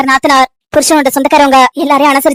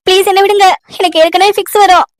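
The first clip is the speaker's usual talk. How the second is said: gasping, higher-pitched begging; breathless pleading; 4 semitones higher than usual. Acted. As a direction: plead between laboured breaths, unable to get air. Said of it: "மூச்சு விட முடியல". (மூச்சு வாங்க கெஞ்சல்)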